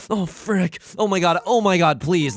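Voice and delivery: panicked pleading. Real, not acted. Player begging the draw to go well, panicked pleading. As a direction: beg the tiles.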